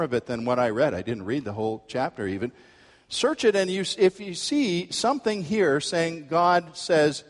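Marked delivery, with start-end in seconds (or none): none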